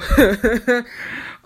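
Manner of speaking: loud burst of laughter. Real, not acted. Laugh after failed footage.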